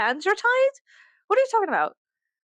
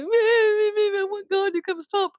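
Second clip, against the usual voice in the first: high, slow, whining mock baby voice; whiny baby; 11 semitones higher, much slower than usual. Imitating whiny doomers, slow high baby whine. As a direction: drag it out like a wailing toddler. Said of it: "You sound like a fucking baby".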